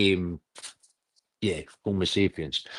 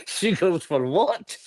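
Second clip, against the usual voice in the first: laughing; 7 semitones higher, slower than usual. Real, not acted. Laughing disbelief.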